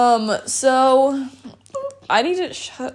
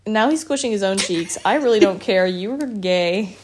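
ordinary talk

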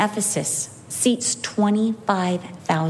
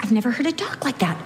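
normal talking voice

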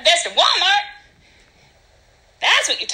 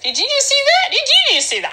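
high, excited mock disbelief; imitated astonishment; 11 semitones higher, faster than usual. Driver asking spouse about the storm, high mock astonishment. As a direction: repeat it high, as if astounded.

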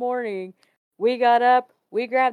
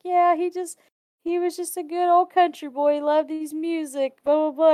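fond waitress; 9 semitones higher than usual. Retold or imitated. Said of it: "She's like".

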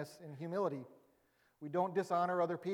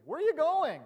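clueless Peter; 11 semitones higher, 10 dB louder than usual. Retold or imitated. Peter's clueless question again.

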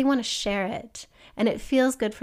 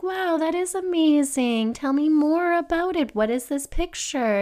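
higher, louder praise like a delighted parent; mock-amazed parent; 6 semitones higher, 4 dB louder than usual. A parent praises the child's stick-figure picture, higher and louder. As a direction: gush over the drawing. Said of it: "and they're like".

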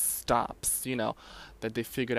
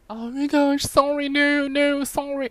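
put-on much higher, apologetic girlfriend voice; flustered girlfriend; 13 semitones higher, 4 dB louder than usual. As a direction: squeak out a panicked apology. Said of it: "And she was like".

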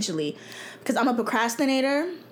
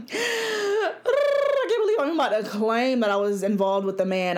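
laughing embarrassed; 3 semitones higher than usual. Real, not acted. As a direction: giggle out the confession. Embarrassed confession.

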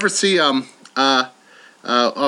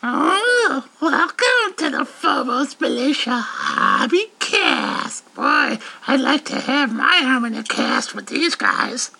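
high, slow sweet doll; nice doll voice; 4 semitones higher, slower than usual. Shown as a demonstration. The doll greets listeners nicely. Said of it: "you want her to do it nicely?".

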